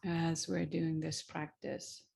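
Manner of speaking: unhurried, gentle guiding with long pauses; guided meditation. Real, not acted. Teacher guiding listeners into the feet and hands, calm meditation voice.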